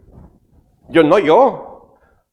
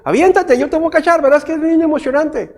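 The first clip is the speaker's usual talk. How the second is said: playful coaxing; 9 semitones higher than usual. Retold or imitated. Father coaxing child to jump.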